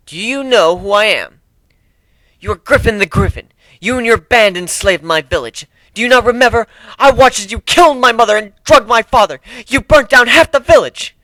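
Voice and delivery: angry accusation. Acted. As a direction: accuse him, voice climbing with rage. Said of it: "she said with another hiss".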